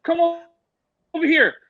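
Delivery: high, mock summoning shout; calling out. Actor calls the wife over from the corner, high call.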